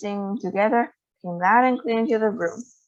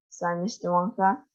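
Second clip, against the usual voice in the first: ordinary delivery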